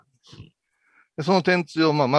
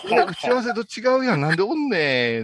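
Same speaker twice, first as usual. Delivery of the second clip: surprised; 9 semitones higher than usual. Retold or imitated. Her own retold surprised protest.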